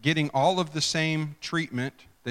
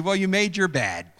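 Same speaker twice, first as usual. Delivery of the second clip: smug told-you-so, pitched up; high, smug scolding; 5 semitones higher, 4 dB louder than usual. Acted. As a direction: say it with knowing superiority. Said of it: "you can hear the smugness".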